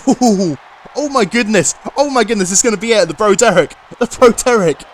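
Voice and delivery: losing it as the finisher hits; excited yelling. Real, not acted. Commentator erupts at finishing move.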